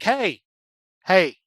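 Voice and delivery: mock shout across to someone; calling out. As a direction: call to get the kids' attention. Demon Hunter hails the giant kids.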